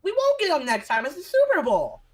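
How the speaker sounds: outraged shout. Real, not acted. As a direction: yell it in disbelief. Yelling at Wilson's attitude.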